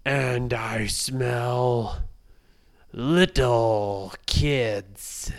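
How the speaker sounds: very slow, loud, booming giant; menacing giant. Acted. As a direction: draw it out like a hungry giant. Giant sniffing out the kids.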